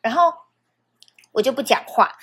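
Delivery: ordinary talk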